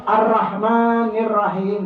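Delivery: "Ar-Rahmanir Rahim" with a thin ra, not ro; wrong reading demo. Shown as a demonstration. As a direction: show the mistake: flat "ra" in "Ar-Rahmanir".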